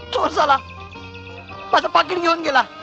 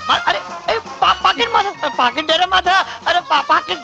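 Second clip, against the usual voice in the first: higher, stammering demands for the wallet; frantic pleading; 7 semitones higher than usual. Acted. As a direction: stutter and plead, pitched up. Constable begging thief to come down. (चोराकडे पाकिट मागताना घाबरलेली विनवणी)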